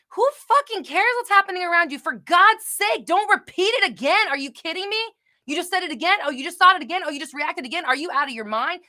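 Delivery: high, loud incredulous rant; exasperated outburst. Acted. What she wants to say to repeaters, exasperated outburst. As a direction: scold them in disbelief, pitch way up. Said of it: "That's really what I wanna say sometimes".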